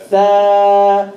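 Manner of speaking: Fa. light, lip on the top teeth; a light, breathy Fa; Arabic letter fa. Shown as a demonstration. Teacher modelling the light letter for students. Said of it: "Fa is a light letter".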